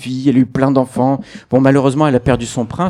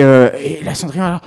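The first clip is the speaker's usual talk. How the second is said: normal talking voice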